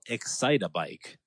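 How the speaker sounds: Excite-A-Bike with an added "a" before Bike; nickname pronunciation. Shown as a demonstration. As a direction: say Excite-A-Bike, an extra "uh" in the middle. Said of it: "Which we used to call".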